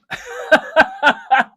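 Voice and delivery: a high, loud amazed laugh; delighted laugh. Real, not acted. Laughing at the coincidence with the mom. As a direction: burst out laughing in wonder.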